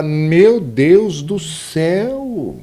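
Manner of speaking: drawn-out exclamation, "Meu Deus do céu" stretched slowly. Shown as a demonstration. Teacher demonstrates an exclamatory sentence, "Meu Deus do céu" drawn out in exclamation. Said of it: "Existem as frases exclamativas".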